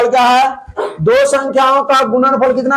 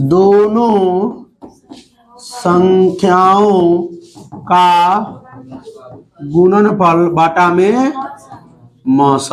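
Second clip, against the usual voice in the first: slow, word by word, pausing for students to write; teacher dictating the formula slowly to the class; slow dictation; 3 semitones lower, much slower than usual. Shown as a demonstration. Dictating slowly, word by word. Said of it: "loss बराबर लिखिए".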